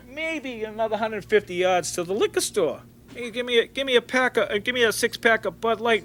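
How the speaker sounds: high, dopey voice for Ronnie; mock stoned brother. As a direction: play the whacked-out brother ordering beer. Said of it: "and he goes".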